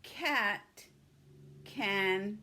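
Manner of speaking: slow word by word. Shown as a demonstration. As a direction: dictate one word at a time. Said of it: "Four, four words".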